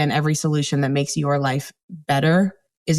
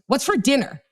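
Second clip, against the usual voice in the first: a pitched-up, curt demand for food; snippy kid tone; 6 semitones higher than usual. Retold or imitated. Teen walking in home, demanding dinner in a snippy tone. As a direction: toss it off like a grumpy teen. Kid's rude question acted out.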